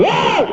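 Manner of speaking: short mock shout to jolt a sleeper; sudden startling yell. Shown as a demonstration. Ustaz startling a dozing listener, sudden yell. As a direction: yell out to wake the audience. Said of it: "memang lantang".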